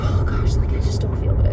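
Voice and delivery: whispered, weary; whispering. Real, not acted. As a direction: whisper it, worn out. Sick mom, quietly complaining.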